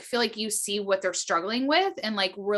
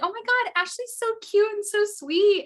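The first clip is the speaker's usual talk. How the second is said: gushing fans; 11 semitones higher than usual. Retold or imitated. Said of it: "they're like".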